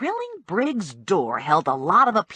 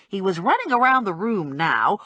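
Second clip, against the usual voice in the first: ordinary delivery, nothing marked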